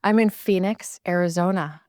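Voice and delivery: normal talking voice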